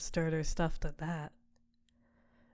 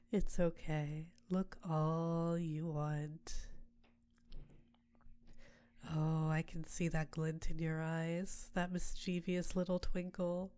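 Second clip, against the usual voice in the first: succubus queen. Acted. Succubus Tiamat to her summoner, seductive close voice. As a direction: purr over your mortal like a temptress.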